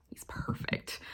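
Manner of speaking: hushed swooning whisper. Real, not acted. To viewers about Rhysand, a swooning whisper. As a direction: breathe it like a confession.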